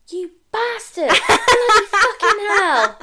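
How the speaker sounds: high, loud laughing swearing; finding the wrong colour flowers delivered, a loud laughing curse; cursing outburst. Retold or imitated. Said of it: "That's what I say".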